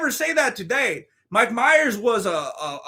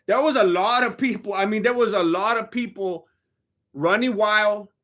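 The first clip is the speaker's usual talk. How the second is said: normal talking voice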